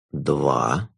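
low, slow, velvety jester; sinister jester. Acted. The Jester counting.

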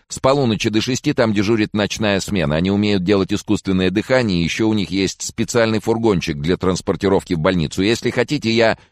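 high-pitched, run-on helpful chatter; eager jabbering. A narrator's reading. Prefect lists night-shift services unasked, jabbering. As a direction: gush information, keen to help. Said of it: "затараторила она, горя желанием помочь".

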